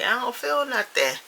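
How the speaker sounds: high-pitched put-on grumble; exaggerated high protest; mock complaint. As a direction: whine it high like an impatient first-timer. Imagined first-timer complaining again.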